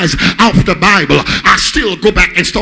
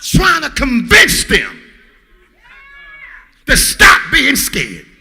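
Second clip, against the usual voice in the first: loud, high, driving sermon voice, music behind; rising gospel preacher cadence, loud and high; full-force preaching; 8 semitones higher, 4 dB louder than usual. Acted. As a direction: preach it hard to the congregation.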